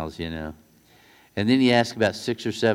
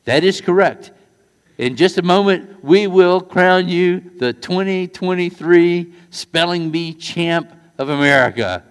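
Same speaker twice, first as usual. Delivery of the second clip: loud, high announcer voice; big, ringing contest-judge announcement; judge's proclamation; 7 semitones higher, 8 dB louder than usual. Retold or imitated. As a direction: proclaim the champion to the whole hall. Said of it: "And the judge says".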